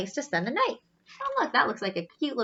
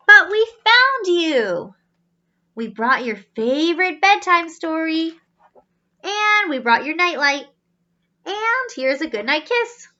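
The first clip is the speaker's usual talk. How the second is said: caring robot family; 4 semitones higher, 6 dB louder than usual. Acted.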